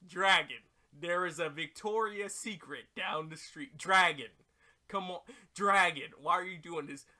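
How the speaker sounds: loud whiny pleading with the dragon; pleading loudly. Pretend. Oolong begging the dragon for panties, loud whiny plea. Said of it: "you're just like".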